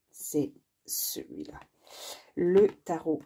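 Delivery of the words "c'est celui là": hushed low whisper. Real, not acted. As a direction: breathe it out in a whisper. Reveals the chosen deck.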